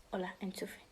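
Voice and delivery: whispered hello to a plug; tiny playful whisper. Pretend. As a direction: secretly greet the plug.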